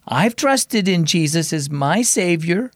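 slow, careful words; slowly. Acted. Girl confides in Mom, slow and hesitant. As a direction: take it slowly, unsure. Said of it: "Raylan said slowly".

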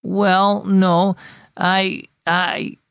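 halting reply with gaps between words; stammering. A narrator's reading. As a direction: stammer it out, unsure of the answer. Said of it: "Bruno stammered".

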